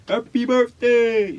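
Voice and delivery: high, stretched cheer; surprise party shout. Retold or imitated. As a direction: yell surprise to the birthday girl.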